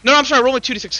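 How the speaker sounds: ordinary delivery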